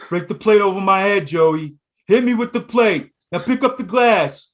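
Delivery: high, loud, insistent yelling; mock De Niro boxer. Boxer daring brother Joey to hit, high shouting. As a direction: goad him at full volume. Acting out Ridiculous Bull scene.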